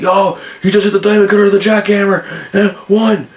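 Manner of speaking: calling the move like a fan gone wild; freaked-out call. Retold or imitated. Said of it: "And Mark's freaked out".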